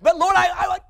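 high, loud mock whine; hammed-up high protest to the Lord; pleading with God. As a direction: play the frantic worrier protesting to God.

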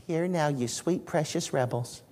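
sweet and gentle. Shown as a demonstration. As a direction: coo it sweetly like a fond parent. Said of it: "I don't think he said it like that".

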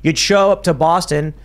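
usual speaking voice

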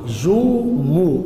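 جمعة as juu-muu, very slow; damma on the meem. Shown as a demonstration. Teacher completing the verse for pupils, جمعة very slowly with long mu. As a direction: stretch each u in جمعة, syllable by syllable. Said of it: "يبقى بضم الميم".

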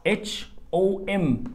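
spelling letters slowly and softly; teacher spelling H O M letter by letter; spelled out slowly. Shown as a demonstration. Teacher spelling a word slowly for the learner. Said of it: "زي".

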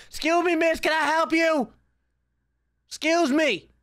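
very high put-on polite voice; officious challenge. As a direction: challenge an intruder with mock courtesy. Confronting intruder in game hallway.

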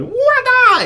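very high, loud kung fu yell; Bruce Lee cry. Shown as a demonstration. Joking about fooling around with a friend in class, a shrill kung fu yell. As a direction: shriek it like a martial arts star. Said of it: "el Bruce Lee".

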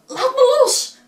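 put-on high, loud cry of a boy; Hans calling out. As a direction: yell to break free. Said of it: "riep Hans".

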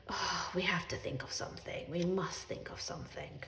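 hushed panicked whisper; anxious whisper. A narrator's reading. Parents fretting over the dead rabbit, anxious whisper. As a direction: plot in a frightened hush.

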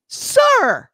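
high, loud, theatrical "Sir"; mock stern scold. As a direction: play a wounded boss dressing someone down.

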